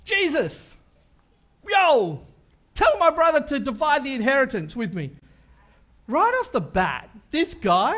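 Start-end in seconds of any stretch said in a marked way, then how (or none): 0.1-5.1 loud, brash demand to Jesus; pushy interrupter. Retold or imitated. Random guy interrupting Jesus, brash and demanding. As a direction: barge in and demand it. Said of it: "just interrupts him going".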